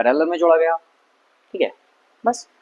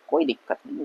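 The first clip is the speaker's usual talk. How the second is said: whispered under the breath; a soft whispered aside; 9 dB quieter than usual. Shown as a demonstration. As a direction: breathe it out without voice. Repeats phrase, then whispers it.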